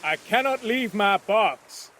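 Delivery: mild shout. Acted. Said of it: "Cornelius shouted again".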